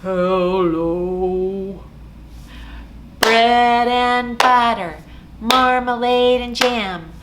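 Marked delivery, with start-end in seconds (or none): 0.0-1.8 a drawn-out lower hello, slow and deep; lowest hello. Shown as a demonstration. Librarian shows kids the lowest hello, deep and slow. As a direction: drag it down low and long. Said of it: "as low as we can".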